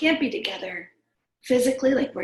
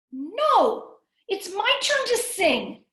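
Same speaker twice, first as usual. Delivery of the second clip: higher, insistent little sister; higher pouty little girl; 8 semitones higher, 4 dB louder, slower than usual. Acted. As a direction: demand your turn like a small child. Little sister voiced in story.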